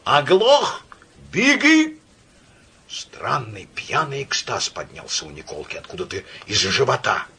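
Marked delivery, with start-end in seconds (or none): 0.1-2.0 high, loud enraged shout; furious roar. A narrator's reading. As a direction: thunder it in fury. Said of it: "он бешено загремел".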